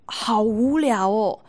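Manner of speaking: drawn-out, slow bored sigh of a phrase. Pretend. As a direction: let the boredom drag slowly. Audience bored with unbeatable hero.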